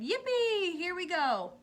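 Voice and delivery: high, loud thrilled whoop; shouted yippee. A narrator's reading. Grandpa Joe on the plunging lift, thrilled shout. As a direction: cheer as the lift drops. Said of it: "Grandpa Joe shouted".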